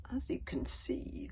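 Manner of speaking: slow whispered drawl. Acted. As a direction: stretch the words, unhurried and smug. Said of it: "drawled the thin prefect".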